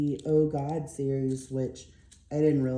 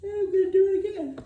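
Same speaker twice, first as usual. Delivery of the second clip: words stretched high by a rising yawn; through a yawn; 5 dB louder, faster than usual. Real, not acted. Apologizing to viewers while yawning again, real yawn. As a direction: let the yawn take over mid-sentence. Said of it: "sorry about that yawn".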